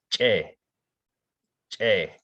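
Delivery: "che" with a throat catch; glottal che. Shown as a demonstration. Teacher demonstrating the glottalized syllable. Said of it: "Then here we have".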